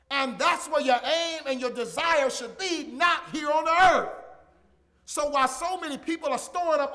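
loud, shouted climax, pitch up; full-force preaching. Acted. Preacher pleading with listeners to store up heaven, shouting. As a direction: shout it out like a revival peak.

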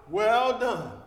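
booming pronouncement of Well done; proclaimed. Acted. God's praise at the last day.

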